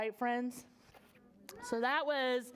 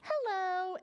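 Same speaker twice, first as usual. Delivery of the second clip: mother's voice; 8 semitones higher than usual. Acted. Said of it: "His mother said".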